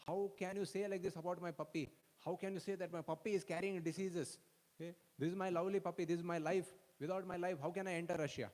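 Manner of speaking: mock-indignant devotee defending his puppy; indignant protest. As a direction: protest hard, as if the puppy is your life.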